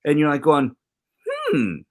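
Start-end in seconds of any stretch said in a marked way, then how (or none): 1.3-1.8 high skeptical hmm; quizzical hum. Retold or imitated. Letter reader, doubtful hmm. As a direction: hum it as if puzzling a letter over. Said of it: "I may have a few questions".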